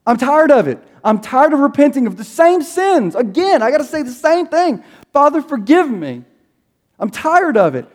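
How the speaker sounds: a tired friend venting on the phone. Retold or imitated. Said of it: "he, like, raised his voice".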